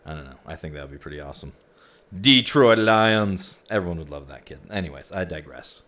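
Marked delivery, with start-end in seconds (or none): 2.2-3.6 loud, slow drawn-out stadium announcement; sports announcer call. Pretend. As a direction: boom it out like an arena announcer. Joke about naming kid Detroit.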